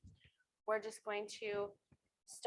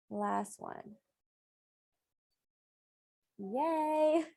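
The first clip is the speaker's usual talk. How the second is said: upbeat encouraging count-off; aerobics instructor; 3 dB louder, much slower than usual. Real, not acted. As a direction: cheer them through the last reps. Counting down the last reps.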